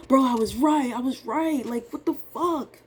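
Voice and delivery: frustrated outburst. Retold or imitated. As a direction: vent at the market, cheated. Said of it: "I take it so personally".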